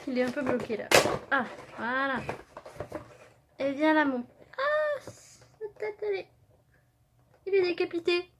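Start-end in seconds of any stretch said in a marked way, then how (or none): 4.6-8.3 real surprise. Real, not acted. Figurine's head seems to fall off.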